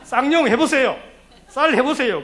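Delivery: teasing an elder who says 쌀 as 살; teasing challenge. Retold or imitated. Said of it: "권사님은 저한테 그런 거 시비할 자격이 없잖아요".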